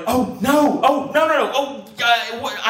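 high, loud rising dread; mock-panicked stammer of "oh no"; inner panic. Inner panic over found grass.

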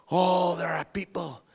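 ordinary delivery

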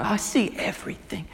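breathless hushed whisper; awed whisper. Retold or imitated. Healed blind man answering Jesus: awed whisper. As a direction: whisper it in wonder.

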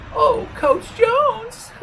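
very high, slow squeaky lady. A narrator's reading. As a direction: squeak it out like a giddy old lady. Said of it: "in her squeaky voice".

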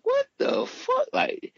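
very high, slow, drawn-out shocked cry; retelling his reaction to the police cover-up, with high stunned outrage; outraged disbelief. Retold or imitated. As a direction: stretch it out high like you're floored. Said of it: "I was like".

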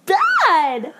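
high-pitched wail of complaint; exasperated outburst. Real, not acted. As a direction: cry out in fed-up disgust. Outburst about how bad it was.

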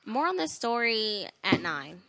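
slow newsreader delivery; news reporter voice. Shown as a demonstration. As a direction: read it like an anchor signing off. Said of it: "in my news reporter voice".

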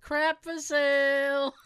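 slow, drawn-out mock sales call; hammed-up slow announcement; mock sale announcer. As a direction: call it out like a joke store banner.

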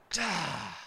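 long low sigh of frustration; soft exasperated sigh; weary sigh. Retold or imitated. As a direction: sigh at the red light. Frustrated at a red light.

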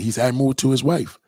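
normal talking voice

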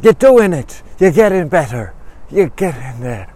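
high, loud praise fading to normal; excited encouragement. Acted. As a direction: start bursting with pride, then settle down. Marianne encourages him after the fall.

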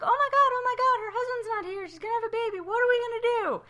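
very high, loud squealing sitcom panic; mock freak-out. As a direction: squeal it like a frantic crowd of neighbors. Acting out everybody freaking out.

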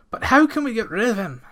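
high, loud anxious question; anxious farmer. A narrator's reading. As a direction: ask in a panic. Frightened farmer asks.

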